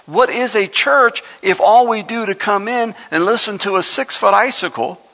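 high-pitched, forceful sermon delivery; impassioned preaching. Real, not acted. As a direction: preach it up high, driving each point home.

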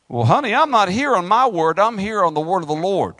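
ordinary talk

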